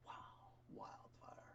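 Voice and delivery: breathy whispered wildfire. Shown as a demonstration. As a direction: whisper the word wildfire like a secret. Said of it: "Yeah, wildfire".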